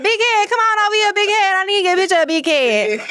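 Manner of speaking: high-pitched excited crowd call. Retold or imitated. Fans calling to the haunt actor, high mock holler. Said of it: "everybody said".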